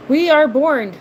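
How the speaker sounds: mock-proud announcement about being from Jersey; proclamation. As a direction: announce it like a grand declaration. Joking about Jersey speech.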